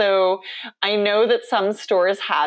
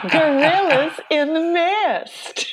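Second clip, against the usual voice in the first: high, slow drawn-out punchline over laughter; laughing punchline; 11 semitones higher, slower than usual. Acted. Delivering the Lady Bunny joke, high laughing punchline. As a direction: sing out the punchline, stretching each word.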